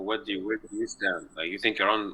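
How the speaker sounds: ordinary talk, nothing marked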